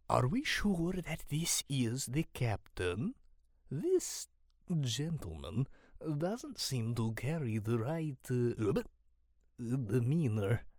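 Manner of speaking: slow, deliberate creature voice; odd-voiced, cautious sidekick with halting phrases; wary amphibian companion. A narrator's reading. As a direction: question it doubtfully, word by word.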